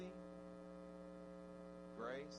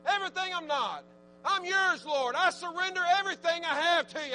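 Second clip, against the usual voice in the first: 1 fervent prayer; 10 semitones higher, 9 dB louder than usual. Acted. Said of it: "Tell Him".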